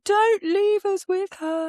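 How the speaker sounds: high, slow whining plea; pleading kids. Retold or imitated. Mother imitating younger siblings begging at the door. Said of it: "stand at the door going".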